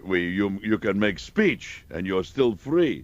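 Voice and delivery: put-on slow, lightly Russian-accented joke; a hint of a Russian cabbie telling a joke. Jack retelling the cabbie's joke, slow light Russian accent.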